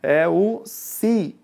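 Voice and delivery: "si" said very slowly and leaned on; stressed syllable drawn out. Shown as a demonstration. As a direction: stretch out "si" to show the stressed syllable. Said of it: "qual é a sílaba tônica".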